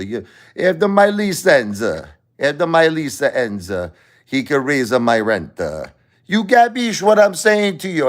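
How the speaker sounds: high, loud mock Italian-American accent; hammed-up Italian-American accent, up high; Sicilian pizzeria owner. Italian-American owner explaining rent, in a hammed-up Italian-American accent. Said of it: "He goes".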